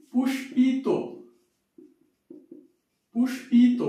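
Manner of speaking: পুষ্পিত said as pushpito for the class; teacher demo word. Shown as a demonstration. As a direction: show পুষ্পিত with the o ending. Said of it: "তাহলে কি হবে দেখো তো".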